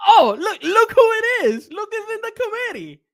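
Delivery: high mocking sing-song; mock surprise, dripping sarcasm; sarcastic. Real, not acted. Sarcastic jab at committee members.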